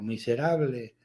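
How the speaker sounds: imitated echo. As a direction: answer like a cave, faintly. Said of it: "y el eco". (eco imitado, grave y apagado)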